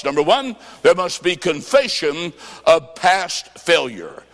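ordinary delivery

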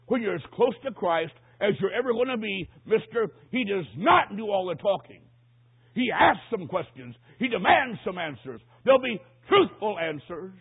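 loud, punched key words; emphatic pulpit delivery; forceful preaching. Real, not acted. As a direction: press the congregation with emphatic demands.

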